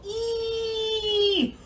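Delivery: very high, stretched mouse squeak; squeaky mouse sound. Shown as a demonstration. Teacher leading face yoga, high mouse squeak. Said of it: "let me see your little whiskers".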